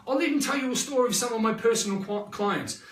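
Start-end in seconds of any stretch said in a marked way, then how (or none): none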